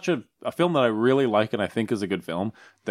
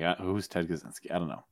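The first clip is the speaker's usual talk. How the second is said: confused mumble; 3 semitones lower, 9 dB quieter than usual. Retold or imitated. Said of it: "He's like".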